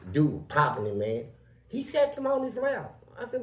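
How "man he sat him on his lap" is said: usual speaking voice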